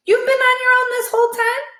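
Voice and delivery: high, loud, rushed question; quick high amazed ask; fast repeat. Shown as a demonstration. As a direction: hurry the repeated line. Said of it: "let me repeat".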